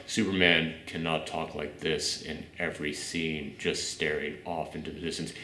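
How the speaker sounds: mock a brooding hero in a hushed, dead monotone; Superman monotone. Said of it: "can't be the same one tone".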